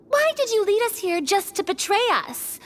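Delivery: ordinary talk, nothing marked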